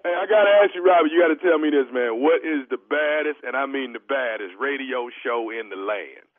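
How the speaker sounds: low, emphatic show-plugging swagger; radio hype. Real, not acted. Prankster host asking victim to plug the show, hyped swagger. Prankster prompts show's boastful slogan.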